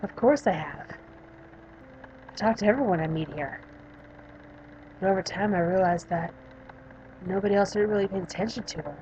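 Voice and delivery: subdued, lightly acted patient character; a hint of a withdrawn young patient; lightly voiced young patient. Patient describing the girl she sees, subdued character voice.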